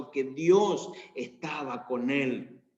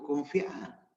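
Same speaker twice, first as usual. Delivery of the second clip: softer, falling "Confía" to calm a frightened man; calm reassurance; 5 dB quieter than usual. Acted. Eliseo calming his frightened servant, quiet and reassuring. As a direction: soothe him with a quiet "Confía". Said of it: "Le dijo".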